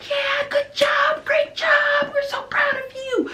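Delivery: very high, drawn-out squeal of encouragement; dramatic preschool-teacher cheering, slow and sky-high; over-the-top praise. Retold or imitated. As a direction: gush as if a tiny achievement were huge. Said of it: "we are very dramatic, very big, very expressive".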